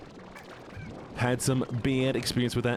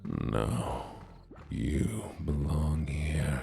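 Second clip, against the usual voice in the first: menacing yandere voice; 5 semitones lower, slower than usual. Acted. Acting yandere voice for redeem.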